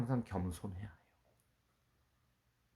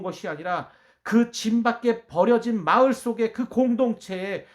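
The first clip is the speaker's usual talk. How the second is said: raised, louder pulpit proclamation; impassioned preaching; 8 semitones higher, 10 dB louder than usual. Acted. Preacher proclaiming Jesus' kingdom to the congregation, impassioned and loud.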